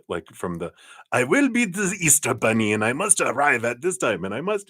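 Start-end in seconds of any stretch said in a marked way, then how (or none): 1.1-4.7 high, regimented German accent, hammed up; mock German accent. Psychic voicing a spirit for a viewer in a bad, regimented German accent. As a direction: bark out the schedule like a strict German Easter bunny. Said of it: "they're giving me a German accent in my head".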